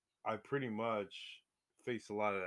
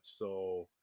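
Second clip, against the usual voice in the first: usual speaking voice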